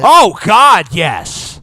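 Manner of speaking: loud, high burst of hammed-up rapture; over-the-top enthusiastic outburst; mock ecstasy. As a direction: gush it at the top of your voice. Hams up love of movie.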